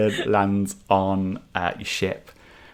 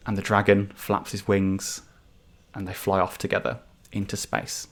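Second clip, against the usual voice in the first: ordinary delivery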